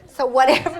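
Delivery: usual speaking voice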